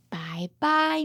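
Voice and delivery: ordinary delivery, nothing marked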